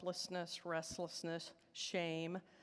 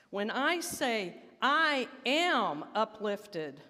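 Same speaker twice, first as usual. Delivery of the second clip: louder, slow, emphatic calls; enthusiastic affirmation; 3 semitones higher, 5 dB louder, slower than usual. Shown as a demonstration. Urging the congregation to mean their affirmations, loud and emphatic. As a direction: say it like you truly mean it. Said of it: "And I say these like you mean it now".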